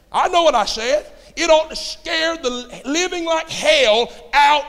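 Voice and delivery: high, loud preacher's shout; impassioned exhortation, stretched words at high pitch; full-force preaching. Acted. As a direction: build to a shouted pulpit climax.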